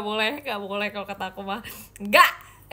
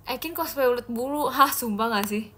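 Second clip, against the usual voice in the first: usual speaking voice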